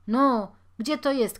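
loud insistent question; insisting. Acted. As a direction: press her, impatient. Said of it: "nalegał Żyd".

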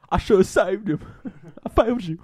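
high, hammed-up grieving Bruce; mock Batman. Friends riffing on the movie, Bruce hammily mourning Alfred. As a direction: overplay Bruce's regret, laughing through.

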